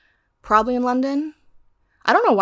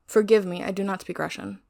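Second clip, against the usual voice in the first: ordinary talk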